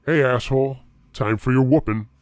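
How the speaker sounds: put-on Jerry ambushes the boys in the forest, a loud taunting call; taunting threat. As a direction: jeer at him before the beating.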